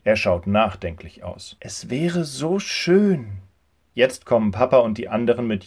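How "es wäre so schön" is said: wistful. A narrator's reading. Josua wistful.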